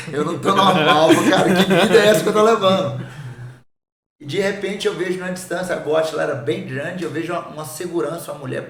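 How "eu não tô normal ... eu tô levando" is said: laughing. Retold or imitated. Laughing at himself on club floor.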